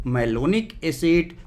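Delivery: very slow, stretched syllables; teacher stretching out Malonic acid; slow drawn-out answer. Shown as a demonstration. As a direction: say the name slowly so they catch it. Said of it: "क्या बोलते हैं इसको?".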